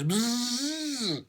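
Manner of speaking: high buzzing bee noise. A narrator's reading. Narrator imitating angry bees near the hive, high buzz. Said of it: "furious buzzing".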